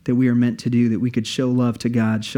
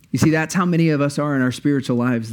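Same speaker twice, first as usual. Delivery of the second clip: ordinary talk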